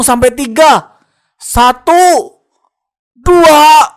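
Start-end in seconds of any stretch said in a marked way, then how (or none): 3.1-3.9 charging yell. Acted. Guard counting before breaking down the door, yelling. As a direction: count building into a loud yell.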